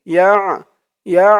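usual speaking voice

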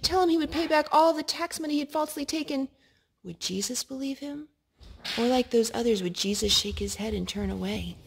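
ordinary delivery

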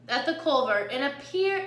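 plain reading voice

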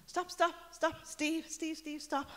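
high urgent call to halt; high-pitched re-enacted calling out; retold shouting.

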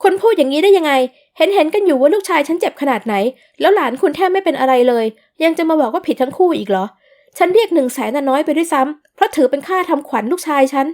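mother shouting at the uncle across the office, loud and outraged; loud outburst. Acted. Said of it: "เริ่มโวยวายเสียงดัง".

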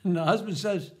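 ordinary delivery

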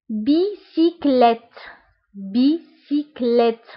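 بيسيكليت spelled out slowly by syllable. Shown as a demonstration. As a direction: break بيسيكليت into slow parts. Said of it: "هادو اللي بالأحمر".

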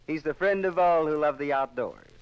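normal talking voice

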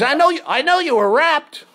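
high, loud attempted Australian; badly held Australian caller; slipping Australian accent. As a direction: put on a wobbly Australian. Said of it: "What happened to your Australian accent?".

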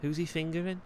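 put-on light Scouse accent as the character Joel; easygoing Liverpool lad in a Scouse voice. Joel chatting with Cyrus, relaxed Scouse accent.

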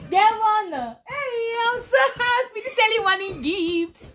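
real happy crying, voice up high; overjoyed. Real, not acted. Teacher thanking a viewer for a gift, high tearful joy.

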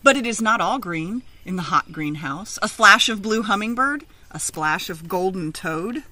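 lively picture-book reading; read-aloud story. Acted. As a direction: read it out to a class of children.

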